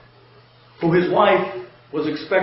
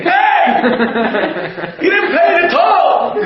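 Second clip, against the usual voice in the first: high, loud shouted call; toll lady screaming; 9 semitones higher, 4 dB louder, slower than usual. Retold or imitated. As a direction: yell after the car that won't stop. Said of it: "comes running out screaming".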